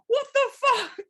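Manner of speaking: high, loud laughing shock; shocked sister. Retold or imitated. Sister reacting to the kneecap on TV, high and loud. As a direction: blurt it out in horror. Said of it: "my sister's going".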